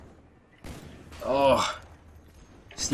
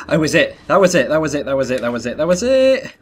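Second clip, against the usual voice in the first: chanted over and over, climbing high at the end; building panic in a loop; frantic repeating; 3 semitones higher, 6 dB louder than usual. Real, not acted. Gamer chanting to viewers mid-fight, rising to a high yelp.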